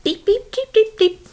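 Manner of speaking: high, tiny beeps; light high-pitched patter of departing hooves; goat trotting off. Acted. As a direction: tap out quick little squeaks. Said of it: "So off went Medium Billy Goat".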